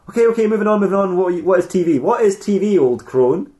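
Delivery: mock-epic princess voice; warrior princess dialogue. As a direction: play the grand warrior princess calling out an old crone. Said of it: "trying to do, like, Xena warrior princess-ish dialogue".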